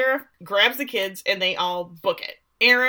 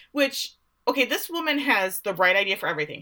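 ordinary talk, nothing marked

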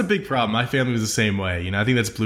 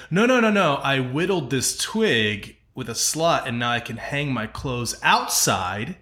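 smug discoverer; 3 semitones higher, slower than usual. Retold or imitated. Mocking a trendy discoverer, slow and proud. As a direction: announce it like a great invention. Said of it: "It's like".

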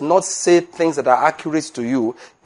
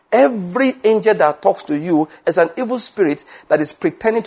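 high-pitched, driving sermon delivery; preaching at full force; 6 semitones higher than usual. Acted. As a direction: preach it hard and high, hammering the point.

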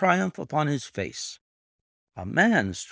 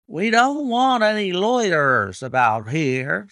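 high, louder, far voice of a surly groom; gruff groom; 8 semitones higher, 5 dB louder than usual. A narrator's reading. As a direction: shoo the strangers off. Groom warding off strangers.

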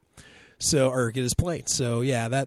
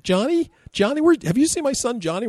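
much higher, louder frantic parent calling; mother searching for her son; worried parent; 12 semitones higher, 5 dB louder than usual. Retold or imitated. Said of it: "They're like".

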